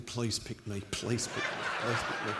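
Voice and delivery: hushed, breathy inner pleading under the breath; nervous inner plea. Retold or imitated. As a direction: beg silently to yourself, half laughing. Silent plea at team announcement.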